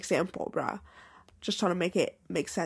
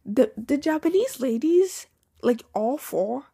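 ordinary delivery

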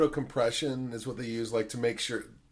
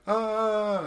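mock kid scream; 9 semitones higher, 4 dB louder than usual. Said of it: "they're like".

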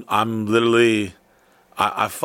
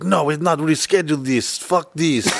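mock-mad Russian accent; imitating an irate Russian client; angry Russian accent. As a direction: refuse curtly in a hammed-up Russian accent. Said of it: "he was so mad".

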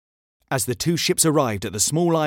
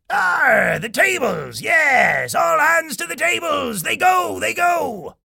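high, rousing pirate shout; pirate captain's yell; 14 semitones higher, 4 dB louder, slower than usual. A narrator's reading. Captain orders all hands to the tables, yelling. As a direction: yell the order with gleeful urgency.

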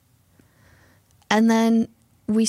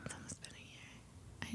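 hushed echo; whisper; 29 dB quieter than usual. Real, not acted. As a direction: whisper it back. Echoing the whisper back.

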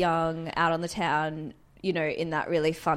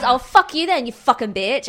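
higher and louder, imitating a drunk girl swearing; hammed-up hostile stranger spitting insults; retelling the stranger's insult in a mock angry voice; 5 semitones higher, 7 dB louder than usual. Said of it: "And she goes".

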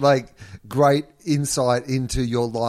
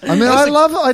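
excited burst; 9 dB louder than usual. Real, not acted.

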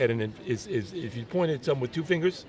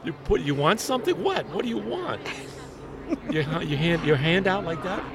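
a lightly voiced, higher indignant local; mock refusal to beggar; 6 semitones higher than usual. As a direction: brush off the beggar, mildly put out. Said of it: "they'll say".

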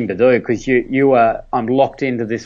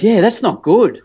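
doctor's verdict; 9 semitones higher, 4 dB louder than usual. Retold or imitated.

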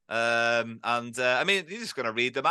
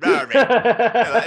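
Irish accent; 6 semitones higher, 10 dB louder, slower than usual. Shown as a demonstration. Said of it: "in an Irish accent".

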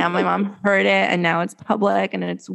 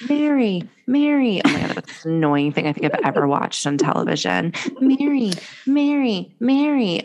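higher-pitched, falling imitation of an annoying call; mocking repeated call; 5 semitones higher than usual. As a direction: mimic that annoying name-calling, pitched up. Mimics annoying repeated TV cry.

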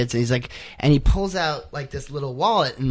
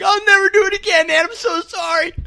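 shaken apology; 4 dB louder than usual. Retold or imitated. Freed kid apologizing in relief.

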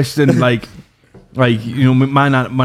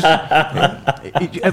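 normal talking voice

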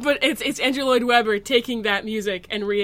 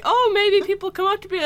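hopeful actors; 11 semitones higher than usual. Retold or imitated. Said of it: "They, like, walked around".

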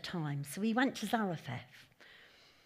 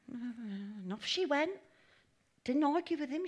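ordinary talk

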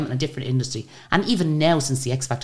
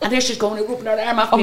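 laughing; 6 semitones higher than usual. Real, not acted. Laughing as she tells the story.

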